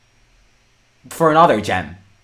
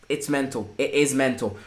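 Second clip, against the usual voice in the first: hushed disbelief; 6 semitones lower, 11 dB quieter than usual. Real, not acted. Drained disbelief after goal.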